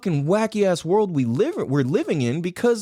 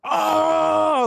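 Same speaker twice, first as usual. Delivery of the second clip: pained cry; 12 semitones higher than usual. Retold or imitated. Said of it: "trying to stand to take a piss was like".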